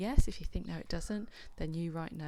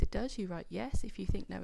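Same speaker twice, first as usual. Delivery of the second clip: normal talking voice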